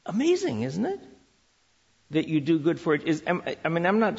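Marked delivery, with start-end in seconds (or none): 0.0-1.0 high amazed exclamation. Real, not acted. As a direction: let the wonder lift the word. Marvelling aloud.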